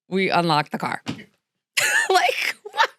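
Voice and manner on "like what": high laughing shout of disbelief. Real, not acted. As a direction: yelp it out in amazement. Disbelief at the ending.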